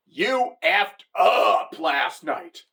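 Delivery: very slow, heavy pronouncement; slow ominous voice. Retold or imitated. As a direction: spell it out slowly like a verdict. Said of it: "the universe is telling me".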